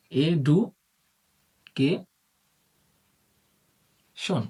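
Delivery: Education very slowly, as four separate syllables; long pauses between the syllables of education; broken into syllables. Shown as a demonstration. Teacher splitting education for students, syllable by syllable. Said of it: "আমরা যদি এডুকেশনকে ভাঙি".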